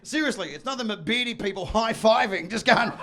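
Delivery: usual speaking voice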